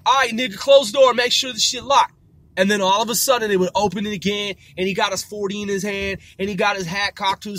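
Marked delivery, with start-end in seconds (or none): 0.0-2.2 retold firm order. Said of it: "you'd be like".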